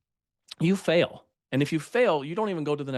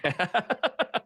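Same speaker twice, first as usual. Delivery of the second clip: high-pitched burst of laughter; 12 semitones higher than usual. Real, not acted. Ski instructor teased about never falling down, laughing. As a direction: laugh at a teasing question, high and real.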